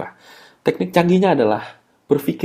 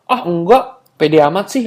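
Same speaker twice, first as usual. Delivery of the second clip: dismissive denial; 5 semitones higher, 5 dB louder than usual. Acted. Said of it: "dia akan bilang".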